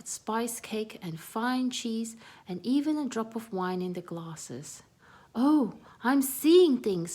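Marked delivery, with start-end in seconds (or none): 5.3-7.1 higher, much louder, slow startled squeak; slow loud high alarmed cry. Acted. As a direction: squeak in disbelief. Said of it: "squeaked Annie suddenly".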